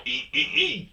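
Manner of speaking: very high squeaky pet noises; high squeaks. Real, not acted. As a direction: squeak to catch the dog's attention. Squeaking at the dog.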